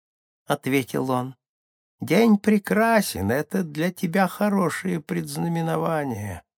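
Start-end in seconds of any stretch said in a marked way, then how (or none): 2.0-6.4 high, thin little voice of a small shaman; Скунду. A narrator's reading. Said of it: "тонкий голосок которого был похож на слабый". (тонкий высокий голосок)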